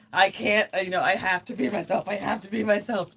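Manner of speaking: loud, rushed retold panic; freaking out. Recalling a realization about being herself, freaking out. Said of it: "I just started freaking out".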